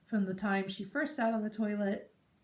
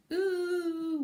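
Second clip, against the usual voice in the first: high, louder pointing "Ooh"; toddler noise; 10 semitones higher, 5 dB louder than usual. Retold or imitated. Toddler pointing at her nappy, high and loud. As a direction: coo like a wordless toddler. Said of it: "she tends to be like".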